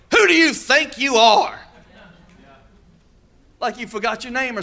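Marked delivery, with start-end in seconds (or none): 0.1-1.6 high, loud, slow scornful challenge; scornful taunt. Retold or imitated. A preacher mimics the scornful question thrown at believers, high and loud. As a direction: sneer it like a scornful relative. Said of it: "this is a good one".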